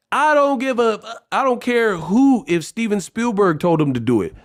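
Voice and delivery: high, emphatic dismissal; fed-up rant, pitched high; exasperated outburst. Real, not acted. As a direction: wave the argument away with pitch jumping up. Ranting at fans' excuses.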